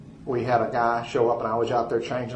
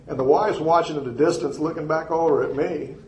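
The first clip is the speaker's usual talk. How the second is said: ordinary talk, nothing marked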